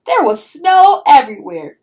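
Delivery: excited. A narrator's reading. Pinkalicious sees snow all over her room, amazed and loud. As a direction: gasp out the wonder of it. Narrator marvels at mess.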